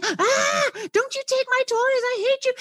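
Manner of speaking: put-on very high wailing kid's voice; child tantrum. Child whose toys are taken away, high wailing tantrum. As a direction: throw a little kid's tantrum.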